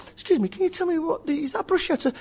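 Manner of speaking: high-pitched mock enquiry; networker at buffet. Asking a stranger about the buffet food, high mock-polite enquiry. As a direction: pipe up as an eager buffet guest.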